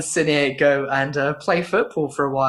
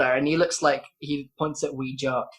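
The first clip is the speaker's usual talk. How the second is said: usual speaking voice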